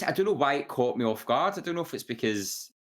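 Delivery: ordinary talk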